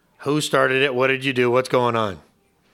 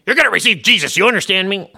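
loud, pushy street-preacher bark; a mock heavy-handed evangelist, half laughing; forceful preaching; 5 semitones higher, 7 dB louder than usual. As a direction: shove the gospel at them. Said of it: "forcing people to listen to the gospel".

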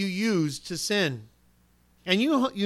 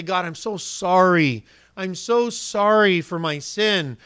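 mock penitent; 4 dB louder, slower than usual. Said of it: "they'll, they'll say".

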